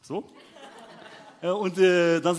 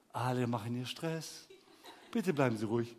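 unhurried calm; 6 semitones lower, 7 dB quieter than usual. Retold or imitated. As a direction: placate them without any hurry. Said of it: "Ganz ruhig erstmal". (betont ruhige Schalterbeamtin)

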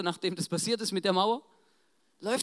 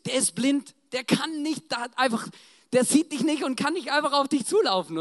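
louder, higher, exasperated objection; incredulous protest; 6 semitones higher, 6 dB louder than usual. Acted. Disciples object to Jesus calling a blind man, incredulous. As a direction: point out the obvious, exasperated.

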